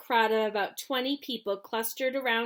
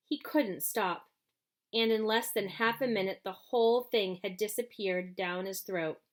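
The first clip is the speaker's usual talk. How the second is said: ordinary delivery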